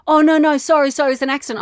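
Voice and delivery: put-on like a cornered kid backing off; lightly voiced sorry teenager; imitating teen boy. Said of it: "He went".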